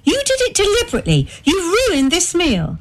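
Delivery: high, fast, rising accusation; high pitched wounded fury; furious accusation. A narrator's reading. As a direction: hurl the blame at him. Wife repeats accusation.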